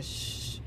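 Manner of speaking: soft shush, all breath; retold shush. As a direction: hush a child, acted out. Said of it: "saw me saying".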